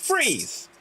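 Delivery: loud high call to stop the scene; called freeze. Real, not acted. Host halts the improv scene, calling out loud. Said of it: "I'll call out freeze".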